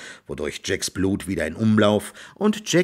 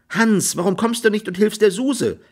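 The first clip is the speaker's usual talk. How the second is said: high-pitched scolding maid; high, reproachful question; 12 semitones higher than usual. A narrator's reading. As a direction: scold him in a raised pitch. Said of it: "schalt Mary".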